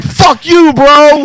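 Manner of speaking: high yelled insult; angry shout. Retold or imitated. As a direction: yell it in his face. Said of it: "was like".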